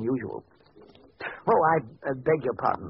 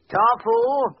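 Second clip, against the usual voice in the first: higher, louder summons; calling a servant; 9 semitones higher, 5 dB louder, much slower than usual. Acted. Doctor calling his servant to fetch the patient, loud. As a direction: call sharply across the house.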